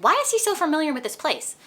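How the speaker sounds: high, loud, suspicious demand; suspicious exasperation. Retold or imitated. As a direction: ask it like you've just caught him out. Violet suspicious of Xaden.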